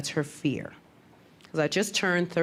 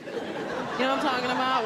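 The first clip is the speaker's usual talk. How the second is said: usual speaking voice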